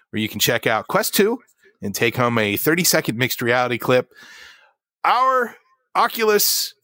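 show-opening news read; news presenter read. Acted. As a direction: announce the top story like a news host. Opens the news segment.

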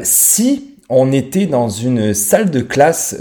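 usual speaking voice

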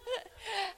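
high delighted laugh. Real, not acted. Preacher laughing at Jesus putting mourners out.

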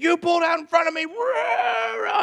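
imitated high screech trailing into roars; mock irate screaming. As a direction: yell it like the furious lady, then growl nonsense. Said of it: "And was just irate".

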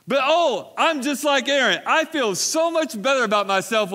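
preacher pounding the point; full-force preaching. Acted.

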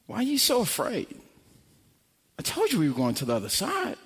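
soft, quick, sleepy grumble; just-woken Jesus. Acted. Jesus woken by frightened disciples, sleepy soft reproach. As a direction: mildly reproach them, barely awake. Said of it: "wiping the sleep out of his eyes".

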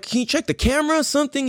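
high, fast, pressing demand; pushy request. Retold or imitated. Demanding proof from the cashier.

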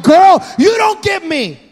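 slow, drawn-out complaint to the phone; exasperated. Retold or imitated. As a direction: tell Siri off, fed up. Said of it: "I'm like".

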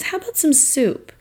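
high, gentle motherly suggestion; lightly voiced caring host; knowing caring offer. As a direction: planner gently offering soup, a hint of mama. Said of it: "she just looks at me and she goes".

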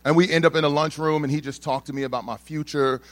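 usual speaking voice

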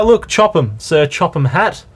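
usual speaking voice

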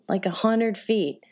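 ordinary talk, nothing marked

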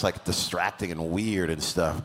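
ordinary talk, nothing marked

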